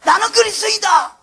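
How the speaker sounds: high, loud chant-like declarations; mock public proclamation. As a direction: shout your titles out to the world, seeking approval. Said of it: "오늘 밖에 나가서". (밖에서 크게 외치며 선포하듯)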